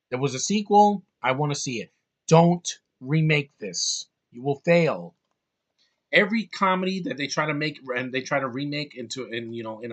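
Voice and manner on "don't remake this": slow, emphatic, hitting DON'T; stern warning. Real, not acted. Warning Hollywood never to remake this film, stern and slow. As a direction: lean hard on "don't" and drag it out.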